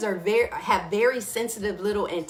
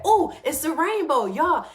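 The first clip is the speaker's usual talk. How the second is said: excited; 7 semitones higher, 5 dB louder than usual. Retold or imitated. People spotting a rainbow.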